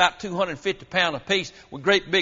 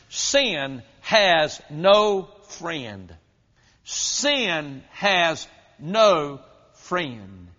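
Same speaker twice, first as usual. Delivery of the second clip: each word drawn out long and heavy; slow, solemn emphasis; much slower than usual. Real, not acted. Preacher warns congregation that sin has no friend, slow and solemn. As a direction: stretch every word as a grave warning. Preacher hammers the point slowly.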